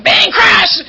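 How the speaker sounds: loud, high mock crash sound; crash noises. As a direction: burst out like a brawl starting. Fight breaking out at party.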